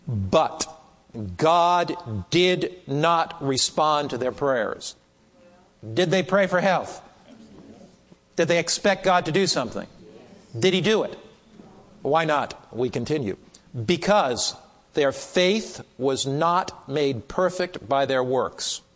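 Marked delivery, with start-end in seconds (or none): 0.1-5.0 very slow, each word set apart; slow, powerful reading. Real, not acted. Preacher reading a precious statement to the class, very slowly. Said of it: "I'll read it slowly because it's so powerful". 13.9-18.8 deliberate, spaced-out phrases; slow, powerful reading. Real, not acted. Preacher resuming a precious statement for the class, very slowly. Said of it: "I'll read it slowly because it's so powerful".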